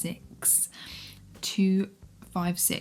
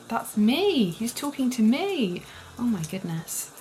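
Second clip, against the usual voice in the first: swooning squeal; 5 semitones higher, slower than usual. Real, not acted. Reader swooning as the hero seems to speak to her, delighted. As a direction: gush as if he speaks to you.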